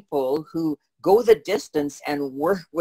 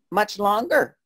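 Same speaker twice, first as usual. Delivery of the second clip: ordinary delivery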